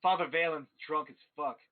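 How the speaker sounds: usual speaking voice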